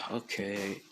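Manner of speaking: heavy sigh, then a soft okay; softly spoken after an audible sigh; sighing acceptance. Retold or imitated. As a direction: imitate a resigned sigh before giving in. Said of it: "he just sighs".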